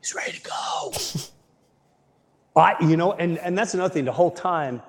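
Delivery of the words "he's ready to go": whisper. Real, not acted. Echoing friend's remark.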